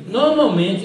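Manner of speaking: ordinary delivery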